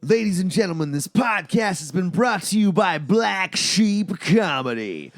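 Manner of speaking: big, slow radio announcer. Acted. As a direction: boom it out like a radio host, slowly. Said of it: "I'm just gonna do my own announcer voice".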